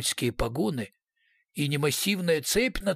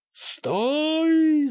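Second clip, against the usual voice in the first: loud, much higher yell; booming shout; 13 semitones higher, 5 dB louder than usual. A narrator's reading. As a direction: bellow after a trolleybus. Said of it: "зычно крикнул".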